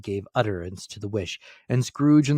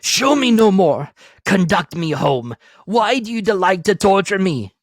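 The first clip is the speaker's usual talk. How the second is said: anguished plea; 10 semitones higher, 10 dB louder than usual. A narrator's reading. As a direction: beg the spirit in anguish. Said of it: "Spirit, said Scrooge".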